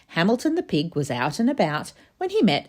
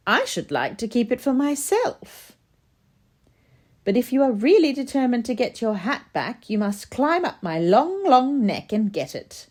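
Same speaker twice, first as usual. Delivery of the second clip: higher, teasing giraffe; 8 semitones higher than usual. Acted. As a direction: tease like a haughty giraffe.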